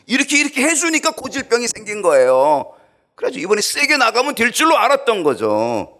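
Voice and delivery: loud, high preaching; fiery preaching. Acted. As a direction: drive the point home. Preaching at full force.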